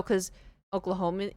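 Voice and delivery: ordinary talk, nothing marked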